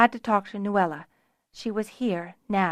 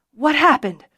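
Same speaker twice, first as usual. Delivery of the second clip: high, loud startled question; alarmed question; 6 semitones higher, 8 dB louder than usual. A narrator's reading. Tamar sees her bandaged leg, alarmed. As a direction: ask with sudden alarm. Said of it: "Taking in her bandaged leg".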